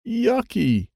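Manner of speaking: yucky. A narrator's reading. Disgusted by monster toy.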